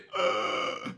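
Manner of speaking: throaty imitated croak; creaky noise. Killer answering the cop, creaky imitated noise. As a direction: let out a short rattling croak.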